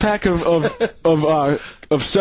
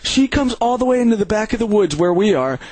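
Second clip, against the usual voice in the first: normal talking voice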